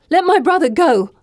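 high, desperate shout. Acted. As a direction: demand it at full voice. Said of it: "I shout".

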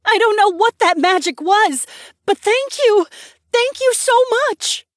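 high, loud, gushing woman; happy gratitude. A narrator's reading. Townswoman thanks the group for magic, happily. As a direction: gush with thanks at high pitch. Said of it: "a woman cried out happily".

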